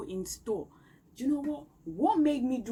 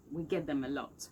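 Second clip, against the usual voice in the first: usual speaking voice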